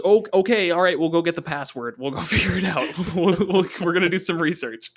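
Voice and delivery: high, loud, hurried backpedal; scrambling to back off; flustered retreat. Pretend. Panicked party backing off.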